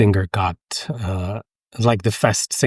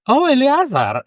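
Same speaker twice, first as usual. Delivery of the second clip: imitating delighted Germans; 3 dB louder than usual. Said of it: "they're like".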